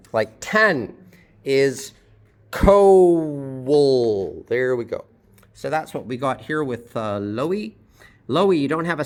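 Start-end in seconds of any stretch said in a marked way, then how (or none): 2.5-4.4 "coal" stretched very long into "co-wwul"; exaggerated two syllables. Shown as a demonstration. Teacher demonstrating the W sound, drawn-out "co-wul". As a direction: lean hard on the W inside "coal". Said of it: "two syllables and we can hear a clear W".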